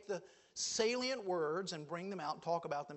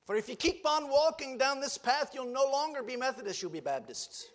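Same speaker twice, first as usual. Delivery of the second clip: quoted preacher; 6 semitones higher, 5 dB louder than usual. Retold or imitated. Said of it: "He goes".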